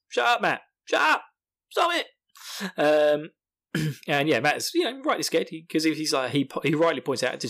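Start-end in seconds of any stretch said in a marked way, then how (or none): 0.1-2.1 rising high-pitched exasperated order; mock snapping. Host as Perrin hushing scared Mat, mock snapping. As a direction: snap with climbing, squeaky urgency. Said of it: "Perrin again says".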